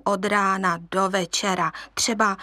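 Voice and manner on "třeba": usual speaking voice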